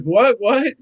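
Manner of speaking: high, startled double question; retold disbelief. Carver hears friend saw his mother, retold disbelief. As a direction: act out stunned disbelief, pitched right up.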